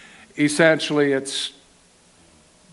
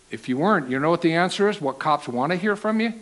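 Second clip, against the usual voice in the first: normal talking voice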